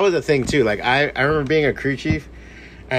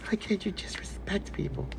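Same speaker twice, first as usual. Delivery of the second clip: high, hushed mock plea; soft, breath-only whisper; mock pleading whisper; 7 semitones higher, 11 dB quieter than usual. Mock plea after the joke.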